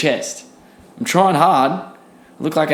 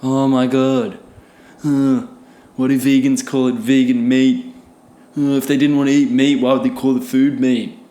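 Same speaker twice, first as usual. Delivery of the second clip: hint of a whiny online critic; mocking a know-it-all commenter. Mocking a commenter who complains about vegan meat, in a lightly smug voice. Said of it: "I've heard about it so much".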